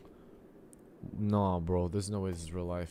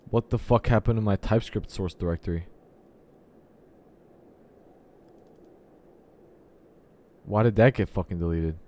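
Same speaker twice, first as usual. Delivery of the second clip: loud dismay; 7 dB louder than usual. Real, not acted. Source code deleted.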